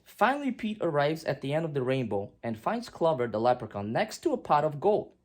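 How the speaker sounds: plain reading voice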